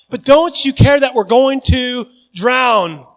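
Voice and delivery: loud, high and slowed; desperate plea. Retold or imitated. Disciple fearing drowning, loud slow plea. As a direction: plead in panic over drowning. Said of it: "That's what I would wanna say".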